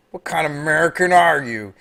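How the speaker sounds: hammed-up American twang; mock how Americans supposedly talk; exaggerated American accent. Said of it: "we all talk like that".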